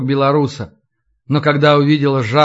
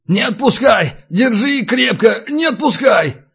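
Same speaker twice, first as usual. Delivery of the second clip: frightened cry; 7 semitones higher than usual. A narrator's reading. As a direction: beg them to hold on, voice raised in pitch. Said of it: "зашарил руками по стене и закричал". (испуганно кричит высоким голосом)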